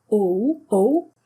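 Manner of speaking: "O" as a two-sound glide, oh-oo; English O. Shown as a demonstration. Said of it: "two sounds in English".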